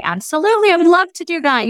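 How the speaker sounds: high-pitched, delighted yes; eager acceptance. Retold or imitated. Young teacher accepting the offer, eager and gushing.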